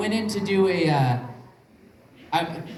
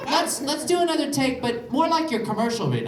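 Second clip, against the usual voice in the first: high mock director; 9 semitones higher than usual. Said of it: "they're like".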